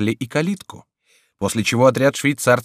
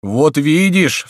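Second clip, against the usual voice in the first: slow, loud, higher frightened exclamation; trembling cry; 6 semitones higher, 7 dB louder, much slower than usual. A narrator's reading. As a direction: cry out, shaking with fear. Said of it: "вскричал трепеща Митон".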